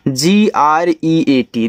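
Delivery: slow, letter by letter; spelling out. Shown as a demonstration. Teacher spelling a word for beginners, slow spelling out. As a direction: spell it out slowly for learners.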